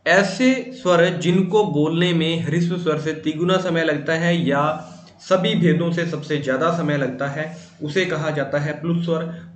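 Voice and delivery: ordinary talk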